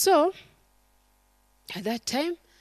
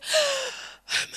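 gasp. Retold or imitated. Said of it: "the little brother was there".

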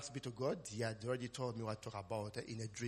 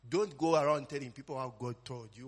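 ordinary talk

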